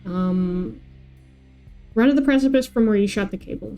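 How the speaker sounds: usual speaking voice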